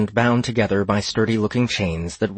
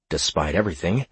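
usual speaking voice

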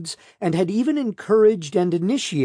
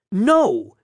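higher, louder, edgy refusal; edgy snap; 7 semitones higher, 5 dB louder than usual. A narrator's reading. Defendant refusing the prosecutor's suggestion, snapping edgily. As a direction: snap it out, pitched up. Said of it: "she snapped edgily".

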